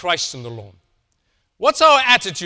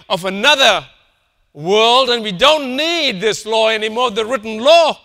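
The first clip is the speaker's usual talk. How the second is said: preaching at full force; 3 semitones higher, 7 dB louder than usual. Acted. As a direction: thunder it from the pulpit, building.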